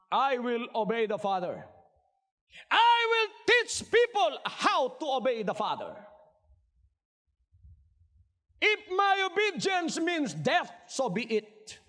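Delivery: voiced vow. Preacher voicing Jesus' vow.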